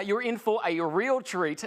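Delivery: ordinary talk, nothing marked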